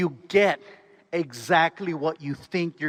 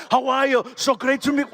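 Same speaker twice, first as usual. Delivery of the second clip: put-on higher, hearty Austrian-accented greeting; Arnold Schwarzenegger; 6 semitones higher than usual. Star greeting the next fan, effusive Austrian impression. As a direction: gush a celebrity hello, then cut off. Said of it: "and he's like".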